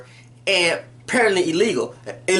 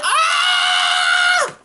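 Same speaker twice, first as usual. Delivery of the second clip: high shrieking scream of shock. Real, not acted. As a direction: scream out in disbelief. Reacting to the stabbing.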